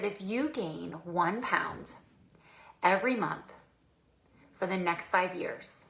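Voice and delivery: slow, soft, with weighty pauses; grave, deliberate warning. Retold or imitated. Trainer paints client a picture of weight gain, gravely deliberate. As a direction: spell it out gently, phrase by phrase.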